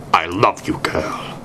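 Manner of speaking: ordinary delivery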